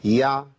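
يع with a short, clean sukun ain; correct ain. Shown as a demonstration. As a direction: give يع a crisp, brief ain. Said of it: "الصح في العين الساكنة إن أقولها كده".

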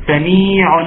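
"سميع" drawn out as samee-unn; stretched "سميع" with a sounded tanween; audible final noon. Shown as a demonstration. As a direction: let the n at the end of "سميع" ring. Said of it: "نون اهي".

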